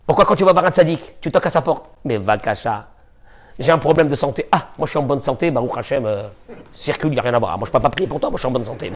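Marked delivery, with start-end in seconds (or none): 2.0-8.9 mock dismissive. Mocking a dismissive tzaddik.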